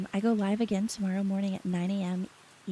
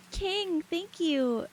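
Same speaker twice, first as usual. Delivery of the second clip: high excited thanks; 9 semitones higher, much slower than usual. Real, not acted. Thanking chatter, squeaky.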